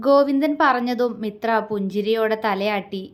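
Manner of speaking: usual speaking voice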